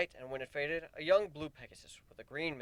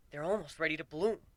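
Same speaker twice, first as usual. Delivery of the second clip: excited; 5 semitones higher than usual. A narrator's reading. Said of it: "Warren said excitedly".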